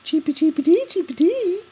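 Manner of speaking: high squeaky chirping; helium voice. Pretend. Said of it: "Can we do that again?".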